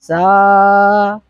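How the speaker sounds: "सा" sung on a low note. Shown as a demonstration. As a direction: sing "सा" low and steady. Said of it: "सा और सा में फर्क है ना".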